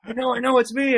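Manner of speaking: lightly voiced celebrity owning it; Jericho's reply. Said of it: "he's like".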